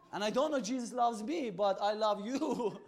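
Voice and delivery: laughing loud through the line; real laughter while retelling. Real, not acted. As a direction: laugh as you say it, slowing at the end.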